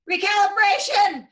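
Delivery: sudden high, loud cry of the word; blurted shout. Retold or imitated. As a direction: shout the word out of your sleep like it bursts from you. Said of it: "I just blurted out the word".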